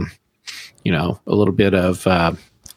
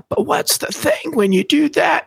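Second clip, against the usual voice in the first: Fry impression. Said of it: "I was gonna try and do a, a, a Fry".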